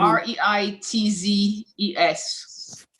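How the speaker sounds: slow, letter-by-letter spelling; spelled out slowly. Real, not acted. Spelling her cousin's surname for the group, slow and deliberate. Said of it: "Say the last name one more time".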